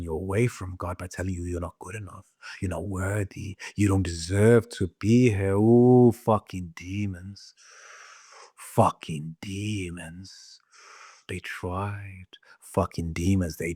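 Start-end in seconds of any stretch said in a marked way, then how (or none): none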